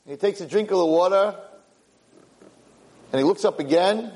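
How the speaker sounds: ordinary delivery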